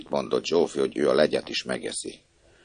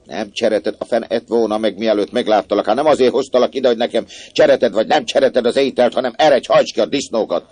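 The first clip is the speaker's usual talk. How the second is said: furious scolding; 6 semitones higher, 7 dB louder than usual. A narrator's reading. Maid rages at refusal.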